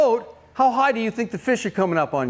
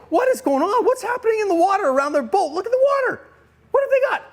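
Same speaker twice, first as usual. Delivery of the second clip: high-pitched excited questions, climbing higher; mounting astonishment; 12 semitones higher than usual. Acted. James and John spotting the churning water, high excited bewilderment. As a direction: squeal it in rising disbelief. Acted puzzlement building to astonishment.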